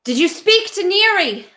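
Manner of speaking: high, demanding call; urgent shout. Acted. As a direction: shout the question across at your sister. Shouted confrontation with hostile sister.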